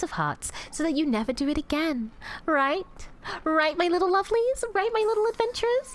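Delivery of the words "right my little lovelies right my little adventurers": higher, sugary cooing question; patronising coo. Acted. Demoness sweetly patronising her jailed chat. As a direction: coo down at them like naughty children.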